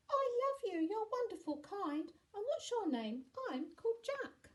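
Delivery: put-on very high, loud child voice; high-pitched, adoring child; affectionate little boy. A narrator's reading. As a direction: gush like a grateful small boy. Small boy thanks the giant.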